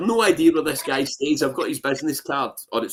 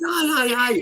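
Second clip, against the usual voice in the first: eager agreement; 9 semitones higher than usual. Retold or imitated. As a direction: shout it out keenly. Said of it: "He said".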